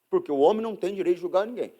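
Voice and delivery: normal talking voice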